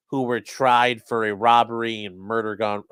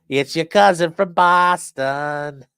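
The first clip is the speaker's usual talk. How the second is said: put-on loud, high, slow Boston accent; hammed-up Boston accent, stretched out loudly; 6 semitones higher, 7 dB louder, slower than usual. Teasing Charlie from up that way, hammed-up Boston accent. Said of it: "Please don't tell me you sound like".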